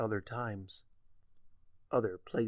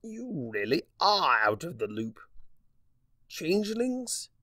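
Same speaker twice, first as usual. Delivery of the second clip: lightly voiced sly Discord; 5 semitones higher than usual. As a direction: savor the words smugly. Discord mocking her ignorance.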